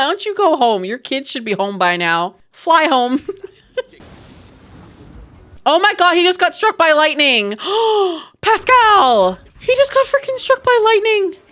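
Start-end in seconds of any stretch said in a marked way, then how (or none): 5.7-11.4 high, loud, gasping exclamations; shocked yelling. Real, not acted. Gamer seeing Pascal struck by lightning, shocked yelling. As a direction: shriek it in alarm, gasp between cries.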